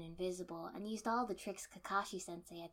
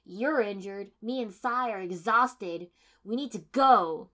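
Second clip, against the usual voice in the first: loud, insistent pleading to leave; forceful arguing; 4 semitones higher, 12 dB louder than usual. A narrator's reading. Kiba urging the team to run, loud and insistent. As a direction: drive every reason home loudly.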